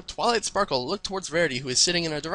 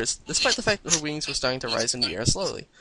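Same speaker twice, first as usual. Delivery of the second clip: plain reading voice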